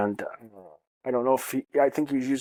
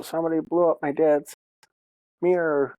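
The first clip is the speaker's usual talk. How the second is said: higher-pitched imitation of the daughter; daughter's voice; 5 semitones higher, 4 dB louder than usual. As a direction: mimic a kid reporting it to mom, pitched up. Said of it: "my daughter told her".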